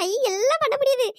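high, jubilant exclamations; delighted cheering. Acted. Woman finds her neck is cured, overjoyed. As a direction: cheer with rising glee.

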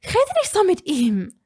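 indignant scolding shout. A narrator's reading. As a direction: tell him off hard. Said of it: "schimpfte Hermine".

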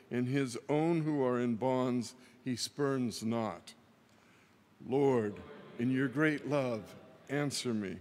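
usual speaking voice

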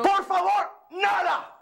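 furious shout, pitched high; raging outburst; angry shout. Acted. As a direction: yell it at her in rage. Furious man confronting woman.

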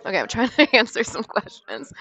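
ordinary delivery

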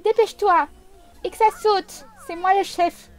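put-on little girl yelling orders at her big sister; bossy child yelling. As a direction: order her sister around like a tiny tyrant. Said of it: "Oui, je vais t'hurler dessus".

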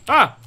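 sudden high loud yelp of fright; startled yelp. Real, not acted. Player jumps at a rising shadow, sudden high yelp. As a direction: jump out of your skin with a short cry.

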